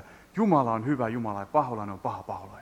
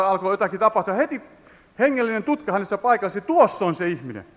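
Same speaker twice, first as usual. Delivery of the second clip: raised, loud revival preacher voice; fiery preaching; 8 semitones higher, 3 dB louder than usual. Acted. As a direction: preach it out to the congregation, high and loud. Preaching at full force. (kiihkeä saarnaääni)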